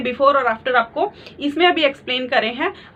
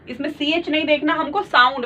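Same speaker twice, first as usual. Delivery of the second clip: normal talking voice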